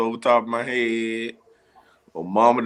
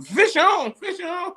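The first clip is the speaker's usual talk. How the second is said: 1 mock yell; slower than usual. Yelling in sleep, retold.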